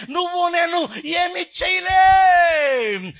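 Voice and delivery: loud, high, drawn-out sermon shout; full-force preaching. Real, not acted. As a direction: preach it at the top of your voice. (గొంతెత్తి ఉద్వేగంగా ప్రసంగం)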